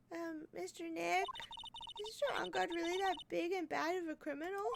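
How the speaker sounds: put-on shy little girl asking; high girlish voice. Voicing timid girl Pearls.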